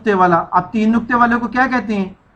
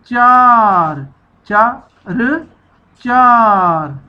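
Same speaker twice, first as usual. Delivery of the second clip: चार stretched very slowly, louder; slow blend; 4 dB louder, much slower than usual. Shown as a demonstration. Teacher modelling the long vowel for pupils, slowly. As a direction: hold the long चा, then roll into र. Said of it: "लंबी आवाज़ हो जाएगी".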